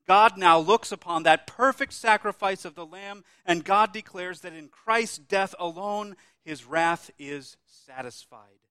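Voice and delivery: loud, ringing proclamation slowing at the end; full-force preaching. Acted. Preacher to congregation retelling the crucifixion, booming preaching. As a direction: thunder it out from the pulpit.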